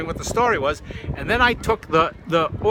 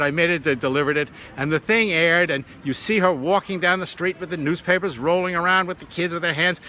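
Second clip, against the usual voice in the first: ordinary talk, nothing marked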